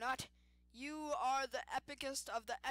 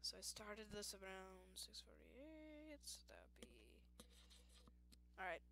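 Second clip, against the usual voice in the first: whispered slow aside, barely audible; whispering; 3 semitones lower, 12 dB quieter than usual. Real, not acted. Player whispering to viewers while counting the time. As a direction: whisper it slowly to yourself.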